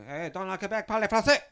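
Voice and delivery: loud, high-pitched heckle from a passer-by; retold yell. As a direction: yell it out, imitating an aggressive stranger. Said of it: "you just yell at me".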